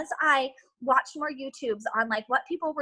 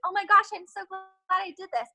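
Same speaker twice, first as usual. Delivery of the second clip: higher, gushing little gasp of joy; imitating delighted recruit; 7 semitones higher than usual. Coach voicing a recruit's future joy, higher and gushing. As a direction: play the thrilled new diamond. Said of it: "you're gonna be like".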